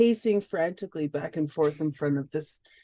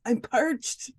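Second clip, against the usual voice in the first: fake cough; 6 semitones higher than usual. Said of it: "charm him in my little coughings".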